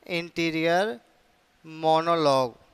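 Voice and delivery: "Interior", pause, then "monologue"; word-by-word dictation. Shown as a demonstration. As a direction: dictate "interior monologue" as two separated words. Term repeated word by word.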